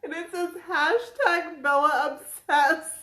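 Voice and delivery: reading the shirt aloud amid laughter; laughing while reading. Real, not acted.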